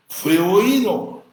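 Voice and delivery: slow, loud proclamation; preaching shout. Acted. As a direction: declare it with full force. Preaching emphasis.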